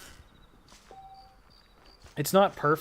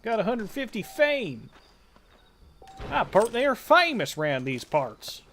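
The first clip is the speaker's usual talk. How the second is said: higher-pitched mock cowboy twang; cowboy accent; 7 semitones higher than usual. Streamer playing cowboy over game winnings, cowboy accent. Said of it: "It's the cowboy accent, I guess".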